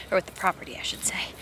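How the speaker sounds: breathy whispered correction; whispered aside. Real, not acted.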